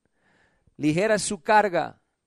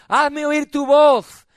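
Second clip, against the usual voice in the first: higher, drawn-out preaching command; insistent demand; 7 semitones higher, 7 dB louder, much slower than usual. Acted. God demanding to hear church's voice, insistent demand. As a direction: call it out, stretching the end. God's demand, after reading verse.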